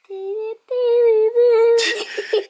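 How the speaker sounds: very high squealing laugh; shrieking laugh. Real, not acted. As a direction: let out a shrill cackle. Big laugh at sneaker collection.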